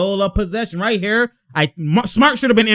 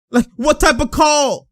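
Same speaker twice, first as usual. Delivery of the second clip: ordinary talk, nothing marked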